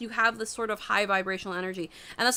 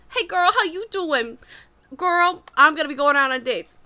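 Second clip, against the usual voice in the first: put-on higher, louder gossiping girl talk; sassy girlfriend chat; 6 semitones higher, 6 dB louder than usual. Friends trading dating news, high and sassy. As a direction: dish it like brunch gossip.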